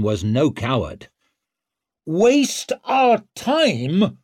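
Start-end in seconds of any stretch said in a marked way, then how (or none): 2.1-4.2 high, loud, drawn-out outrage; incredulous full-voiced challenge; shouting. A narrator's reading. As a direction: shout it slowly across the chamber. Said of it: "shouted Leo".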